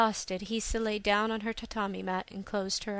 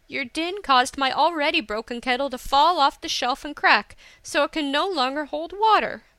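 higher, louder list of grievances; heated complaint; indignant scolding; 4 semitones higher, 6 dB louder than usual. A narrator's reading. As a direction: lay the blame on him forcefully. Hisa continues scolding him.

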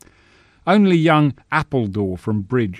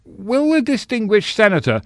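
high squeak, much higher; squeaky voice; 12 semitones higher, 5 dB louder than usual. Acted. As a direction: squeak it out nervously. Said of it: "managed to squeak out".

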